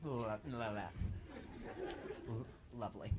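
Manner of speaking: soft, low stammer; stuttering. Real, not acted. Comic apologizes for his tongue, real stutter. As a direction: get stuck on "lo-" before "lovely". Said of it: "Sorry, my tongue is".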